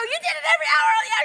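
very high, loud, racing question; hammed-up shrieking amazement; astonished shriek. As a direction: squeal it fast in disbelief. Astonished question in retold talk.

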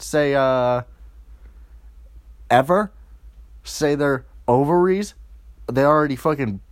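normal talking voice

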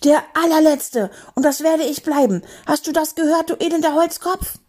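high-pitched furious tirade; indignant high outburst; angry roar. Acted. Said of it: "brüllte er".

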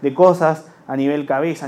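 usual speaking voice

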